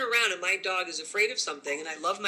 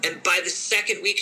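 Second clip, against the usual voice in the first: ordinary talk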